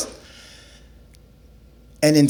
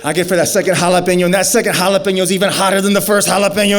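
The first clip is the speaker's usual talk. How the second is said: ordinary talk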